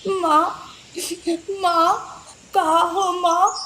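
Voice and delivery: put-on high, small child's wail; crying lion cub. Lost lion cub crying for his mother, high wail. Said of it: "शेर के बच्चे की रोने की आवाज़".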